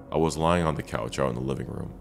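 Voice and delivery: usual speaking voice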